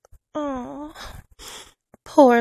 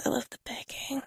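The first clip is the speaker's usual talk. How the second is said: much softer, breathy whisper; 12 dB quieter, faster than usual. Acted. Mistress savouring his begging, a hushed whisper.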